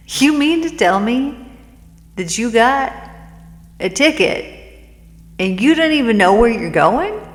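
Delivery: loud, high mocking disbelief; hammed-up clerk who can't believe his ears; incredulous clerk. Station clerk mocks the ticket holder.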